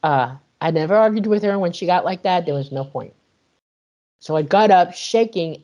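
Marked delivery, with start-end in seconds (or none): none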